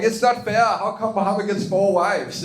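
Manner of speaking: usual speaking voice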